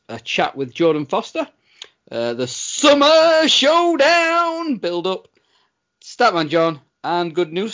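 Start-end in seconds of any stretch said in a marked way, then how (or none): 2.3-5.3 drawn-out, higher trailer voice; segment announcer. Acted. Host teasing the next segment, slow trailer-style announcement.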